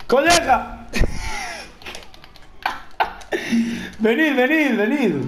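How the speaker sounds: high calling out to the crowd. Real, not acted. Gamer calling NPCs to come at him, high call. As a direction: beckon them in, up high.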